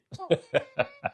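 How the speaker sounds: laugh. Real, not acted. Laughs at own bit.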